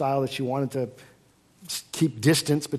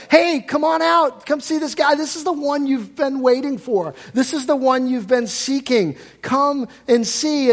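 higher, louder, eager call to come and see; excited calling out; 10 semitones higher, 5 dB louder than usual. Acted. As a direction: call out to a friend with thrilled urgency. Philip calling Nathanael excitedly.

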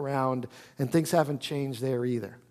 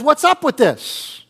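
indignant complaint; 6 semitones higher, 5 dB louder than usual. Retold or imitated. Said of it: "And he basically says".

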